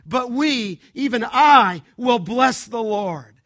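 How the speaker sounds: loud, ringing proclamation, rising to a shout; preacher voicing David with full-throated conviction; David's defiant vow. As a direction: thunder out the resolve against the others. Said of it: "It's almost as though David would say".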